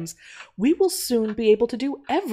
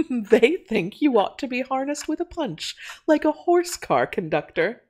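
higher voice with a laugh leading in; amused, laughing; 7 semitones higher than usual. A narrator's reading. Miss Archer teasing Natty about customers, amused and laughing. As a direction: say it through light laughter. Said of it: "said Miss Archer, laughing".

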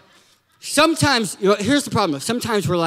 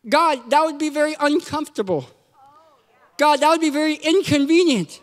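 high-pitched mock complaining; whiny protest; 5 semitones higher than usual. Believer protests God's order to move, whiny complaint. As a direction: object pleadingly, piling up excuses.

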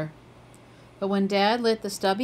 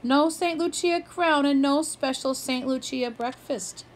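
tearful complaint; 6 semitones higher than usual. A narrator's reading. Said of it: "Liv started to cry".

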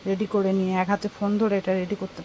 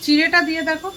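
loud, raised voice calling across the house to the cook; 6 semitones higher, 4 dB louder than usual. Real, not acted. Calling to the cook in the kitchen, loud and raised. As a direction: call it out so the kitchen hears.

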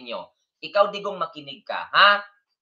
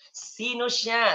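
slow, higher, stretched "Sino siya?"; drawn-out teasing; 6 semitones higher, much slower than usual. Pretend. Same question repeated, drawn out. (mabagal at mataas na "Sino siya?")

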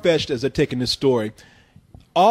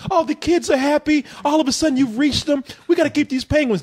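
high, singsong sappy tone; a syrupy Hollywood cliché; sappy movie voice; 9 semitones higher than usual. Retold or imitated. Mocking movie logic, high singsong. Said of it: "movie land where it's like".

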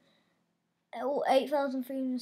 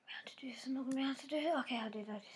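whisper; 7 dB quieter, much faster than usual. Real, not acted. Tense moment, whispering to himself.

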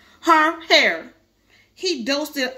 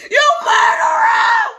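very high, drawn-out wailing scream; accusing scream; 11 semitones higher, 7 dB louder, much slower than usual. Acted. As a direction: scream the accusation at your sister. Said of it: "Herbie screamed".

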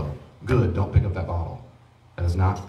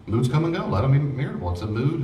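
usual speaking voice

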